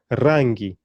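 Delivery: rangi with a trilled r; rangi, strongly rolled opening r. Shown as a demonstration. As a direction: roll the R at the start of rangi. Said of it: "We've got the rolling R".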